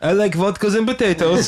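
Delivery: high, loud, hammed-up Russian accent; mock Russian accent. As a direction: play a cartoon Russian saying what he likes. Joking about being mistaken for Russian.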